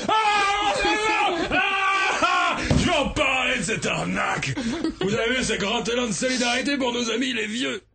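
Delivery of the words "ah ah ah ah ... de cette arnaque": shouting. Acted. Ranting politician opens outraged speech.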